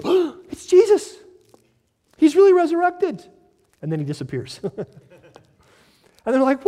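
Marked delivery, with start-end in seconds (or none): 0.0-3.2 high, loud gasping amazement; eyes suddenly opened, astonished; amazed realization. Acted. Disciples' amazed recognition.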